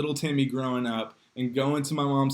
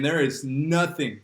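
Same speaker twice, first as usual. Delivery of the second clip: normal talking voice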